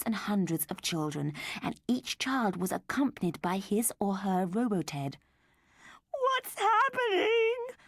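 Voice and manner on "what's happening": high, slow, crying wail. A narrator's reading. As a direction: panic as a mother.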